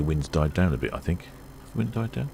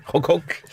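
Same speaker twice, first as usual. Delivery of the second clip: high, playful honk honk; silly clown; 11 semitones higher than usual. Retold or imitated. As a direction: toot like a silly horn. Imitating the ship's horn.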